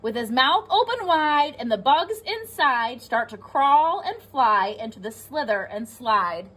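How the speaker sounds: ordinary delivery, nothing marked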